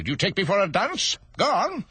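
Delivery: high, impatient royal scolding; irritated king. Acted.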